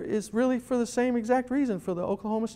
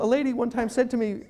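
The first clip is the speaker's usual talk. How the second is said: ordinary delivery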